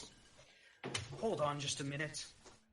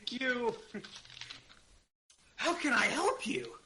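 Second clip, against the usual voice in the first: higher, louder shopkeeper voice; 7 semitones higher, 5 dB louder than usual. Acted. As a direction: greet the customers as the owner.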